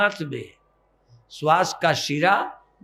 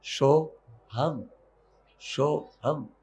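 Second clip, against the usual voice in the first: hushed, drawn-out meditative mantra; mantra on the breath; 2 semitones lower, 4 dB quieter, much slower than usual. Shown as a demonstration. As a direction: draw the mantra out softly. Demonstrates the mantra on slow breaths.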